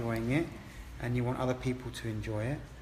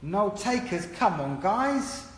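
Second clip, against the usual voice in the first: mock-exasperated echo; 8 semitones higher, 4 dB louder, much slower than usual. Real, not acted. Teasing the silent class.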